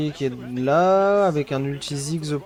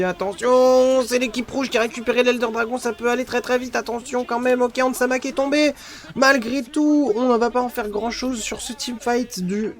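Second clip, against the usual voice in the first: fast, excited play-by-play; esports caster; 7 semitones higher than usual. Real, not acted. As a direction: call the fight live.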